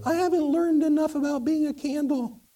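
timid high-pitched candle; little voice. Acted. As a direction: confess it in a tiny, very high voice. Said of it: "this little voice say".

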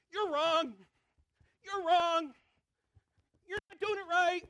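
high, loud scolding; bossy accusing. Retold or imitated. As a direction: point the finger and yell.

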